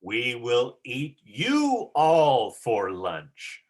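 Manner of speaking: very slow, drawn-out threat; slow menacing demo. Shown as a demonstration. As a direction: stretch every word like a looming ogre. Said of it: "Try that again".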